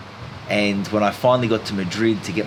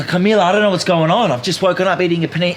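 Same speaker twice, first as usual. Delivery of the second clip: louder, higher, rushed and frantic; 6 semitones higher, 5 dB louder, faster than usual. Retold or imitated. To the hostel girl, louder frantic rush. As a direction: blurt it all out in a panic. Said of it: "I go".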